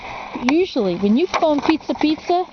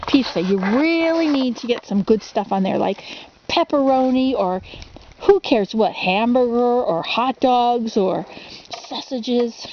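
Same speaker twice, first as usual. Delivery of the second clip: ordinary delivery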